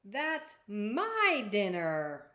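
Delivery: loud, very slow creature growl-free drawl; a big beast staking a claim; beastie voice. Acted. Beastie telling the princess that's his dinner, slow and loud.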